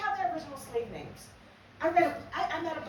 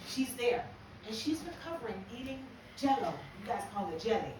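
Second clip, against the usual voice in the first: usual speaking voice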